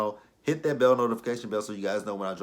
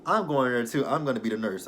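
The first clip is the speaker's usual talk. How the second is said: mock perky nurse; 5 semitones higher, 5 dB louder than usual. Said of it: "She's like".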